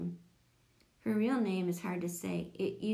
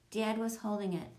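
usual speaking voice

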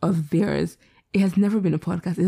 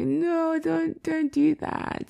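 much higher, slow, drawn-out no; mock refusal; 11 semitones higher, much slower than usual. Refusing a fan in person, high slow mock plea. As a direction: plead it high and slow, half-joking. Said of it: "I'm like".

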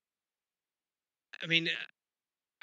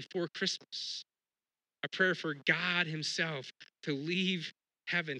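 ordinary talk

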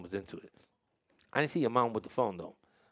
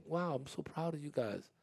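soft, higher voice, acting choked up; mock choked up; 5 semitones higher, 8 dB quieter than usual. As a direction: play a proud parent getting choked up. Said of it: "you get choked up".

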